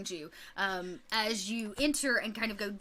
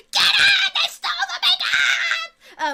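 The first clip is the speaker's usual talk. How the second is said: very high, loud shrieking chase cry; high loud goblin mob shriek; goblin horde yell; 10 dB louder than usual. Acted.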